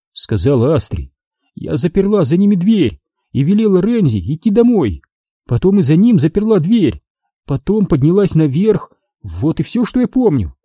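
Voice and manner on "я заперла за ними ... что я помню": Astri voiced high. A narrator's reading. Dazed daughter asks her father what happened, pitched high.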